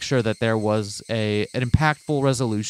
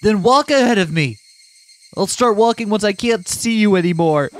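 angry shouting; 10 semitones higher, 6 dB louder than usual. Acted. Keiichi snapping at Rena.

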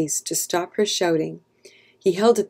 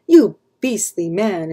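slow, louder accusation; indignant cry; 6 dB louder than usual. A narrator's reading. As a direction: cry out, drawing the words out in outrage. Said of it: "Emilia cried".

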